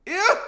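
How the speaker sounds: high, loud ew of disgust; disgusted yelp. Real, not acted. Cook first tastes the tahini, disgusted yelp. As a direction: yelp it out sharply.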